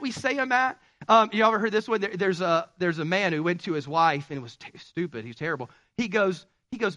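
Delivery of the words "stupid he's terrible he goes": ordinary talk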